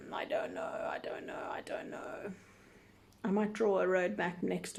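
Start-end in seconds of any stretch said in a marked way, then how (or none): 0.1-2.3 whisper. Real, not acted. Trails off repeating to herself.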